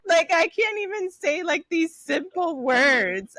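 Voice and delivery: high, loud mock mortification; comic, high-pitched embarrassed wail. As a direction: exclaim it like you're retelling your own embarrassment. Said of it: "just being so embarrassed".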